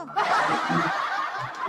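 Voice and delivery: short breathy embarrassed laugh. Real, not acted. Caller laughing when the host repeats the flirting, embarrassed breathy laugh. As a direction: laugh it off, a bit sheepish.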